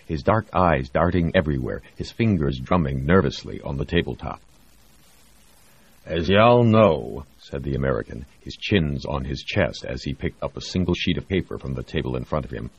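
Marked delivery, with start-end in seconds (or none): 6.0-7.3 lazy, slow Texas drawl. Acted. Texan director opening the meeting, slow South Texas drawl. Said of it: "a trace of a South Texas twang".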